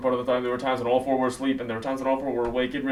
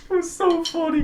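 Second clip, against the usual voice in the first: high, squeezed-out words through laughter; laughing; slower than usual. Real, not acted. Vlogger remembering friends' stifled giggles, laughing. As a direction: crack up while talking. Cracking up at the memory.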